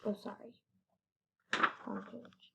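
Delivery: soft whispered apology; hushed correction; murmured aside. Real, not acted. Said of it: "aside fixing page".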